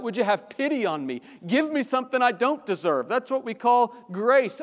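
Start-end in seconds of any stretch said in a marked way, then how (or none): 0.0-3.1 higher, louder desperate plea; crying out. Acted. Preacher voicing the psalmist crying out for pity. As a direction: cry out for pity. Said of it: "And then he cries out".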